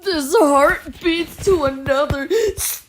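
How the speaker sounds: strained cartoon voice, cracking into laughter at the end; throat-straining impression that falls apart; failed impression. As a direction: attempt a character voice and break down laughing. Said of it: "I cannot do the voice".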